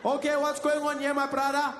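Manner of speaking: high, loud and slow, stretched greeting; insistent South African greeter. Acted. Specialist scolding the suspect for skipping hello.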